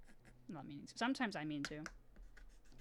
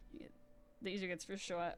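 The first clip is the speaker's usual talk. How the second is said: ordinary delivery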